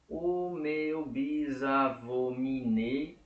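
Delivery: very slow, stretched syllables ending on "nei"; syllable-by-syllable counting. Shown as a demonstration. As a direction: sound out the verse one syllable at a time. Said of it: "Por que eu contei até o nei".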